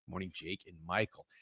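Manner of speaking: usual speaking voice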